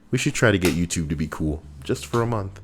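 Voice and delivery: ordinary talk, nothing marked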